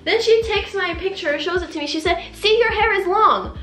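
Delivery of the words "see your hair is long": high-pitched imitation of a stylist proving her point; mimicked insistence. Stylist shows photo as proof.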